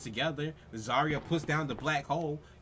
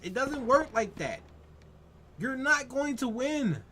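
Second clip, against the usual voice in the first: exasperated rant; 10 semitones higher than usual. Real, not acted. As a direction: lecture him in frustration. Continues ranting at the player.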